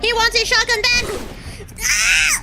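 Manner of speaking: panicked screaming. Real, not acted. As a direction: scream as he runs from the enemy. Chased by an enemy with shotgun.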